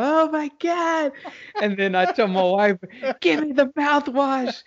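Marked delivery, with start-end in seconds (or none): 0.0-1.1 high, drawn-out fake distress; hammed-up wail while scrubbing the face; mock crying wail. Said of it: "I'm, like, crying, washing my face". 3.2-4.6 high-pitched, urgent plea; mock desperate demand. Acted desperate demand to wife.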